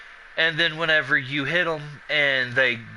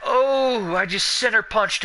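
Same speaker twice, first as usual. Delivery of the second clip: real burst of excitement; excited exclamation; 7 semitones higher than usual. Real, not acted. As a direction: react to a big hit in the game. Reacts to his in-game shot.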